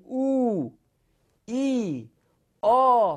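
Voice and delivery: "او", "ای", "آ" as long held oo, ee, aa; drawn-out long vowels. Shown as a demonstration. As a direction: stretch each vowel on its own: "او", "ای", "آ". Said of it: "باید کشیده بخونیم".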